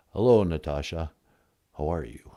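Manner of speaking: a mock-solemn caller named Tonto; low solemn Tonto voice.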